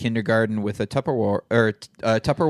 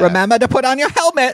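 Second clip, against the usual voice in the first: high, louder motherly reminder; 11 semitones higher, 5 dB louder than usual. Retold or imitated.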